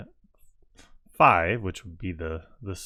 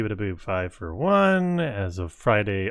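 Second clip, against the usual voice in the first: usual speaking voice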